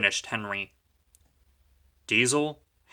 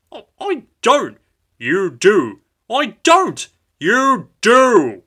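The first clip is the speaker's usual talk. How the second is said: bickering; 10 semitones higher, 11 dB louder, slower than usual. A narrator's reading. Childish quarrel.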